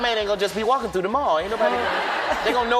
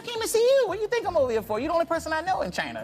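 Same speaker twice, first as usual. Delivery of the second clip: high excited voice; 8 semitones higher, faster than usual. Retold or imitated. Retold reply, laughing.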